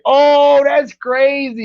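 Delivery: high, drawn-out "oh, that's crazy"; retold amazed reaction. Host recalling their own reaction, amazed and drawn-out. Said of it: "And when I said".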